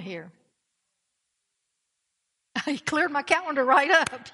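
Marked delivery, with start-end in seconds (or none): 2.5-4.3 laughing through the line. Real, not acted. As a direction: chuckle it out, amused. Laughing at own joke.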